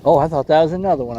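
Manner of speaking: ordinary delivery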